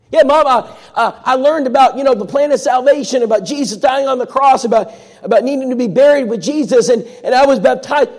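high, loud, tumbling and stammering with joy; can hardly get the words out for excitement; breathless excitement. Retold or imitated. Callie bursting to tell her mom she was baptized, high and breathless.

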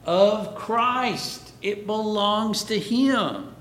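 "Christ" pitched high and emphatic; stress on CHRIST. Shown as a demonstration. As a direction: punch "Christ", then press the point home. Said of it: "The emphasis is not on church".

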